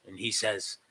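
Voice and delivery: normal talking voice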